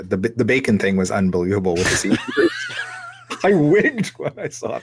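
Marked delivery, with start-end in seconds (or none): 2.2-3.4 shouting laugh. Real, not acted. Bacon voice command story. 3.4-4.8 words through delighted laughter; laughing. Real, not acted. Bacon voice command story.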